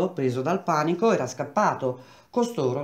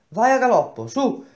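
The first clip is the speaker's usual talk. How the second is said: urgent order; 8 semitones higher, 5 dB louder than usual. Acted. Engineer urging messenger to hurry.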